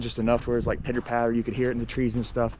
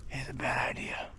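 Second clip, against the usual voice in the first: whisper; 9 dB quieter than usual. Real, not acted. Hiding from a truck.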